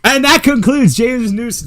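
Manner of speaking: loud, high mock announcer wrap-up; announcer sign-off. Host jokingly ending James's segment, loud announcer voice. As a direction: close the segment with a big announcer flourish.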